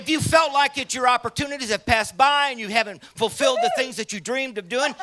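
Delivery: loud, high, rousing sermon voice; fiery preaching. Acted. Preacher firing up baby boomers at the conference, rousing shout. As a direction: lift the room like a revival preacher.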